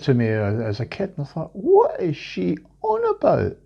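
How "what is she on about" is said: very high, drawn-out puzzled question; mock bafflement. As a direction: recall a kid baffled by granny, pitched way up. Retold childhood bafflement at granny.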